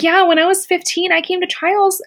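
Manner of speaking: high, lightly voiced consoling mentor; a hint of a sweet older star saying honey; Summer Sanders. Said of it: "She's like".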